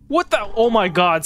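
usual speaking voice

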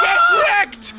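very high screamed payoff; ecstatic shout. Real, not acted. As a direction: shriek the word in triumph. Teamfight climax.

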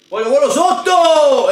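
high, loud yell at the game; frustrated shout. Real, not acted. Gamer yelling at the drawer he wants, loud and high. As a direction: shout it out, fed up.

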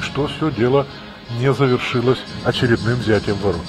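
ordinary talk, nothing marked

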